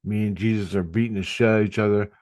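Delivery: normal talking voice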